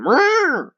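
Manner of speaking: high squeaky little cat meow; a tiny, feeble kitten mew; wimpy meow. Shown as a demonstration. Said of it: "if it's just a cat going".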